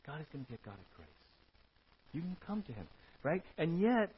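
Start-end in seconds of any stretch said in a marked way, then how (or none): 0.1-1.1 much softer, fast and hushed; softer repeat. Shown as a demonstration. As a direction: let it sink in quietly. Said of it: "repeated softer".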